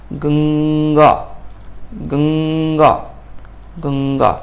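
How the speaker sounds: गंगा said slowly, the middle nasal as 'ng' before g: gang-gaa; drawn-out गंगा with a clear 'ng' sound in the middle; slow pronunciation demo. Shown as a demonstration. Sanskrit teacher showing students the nasal in गंगा, slowly. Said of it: "अंग का उच्चारण".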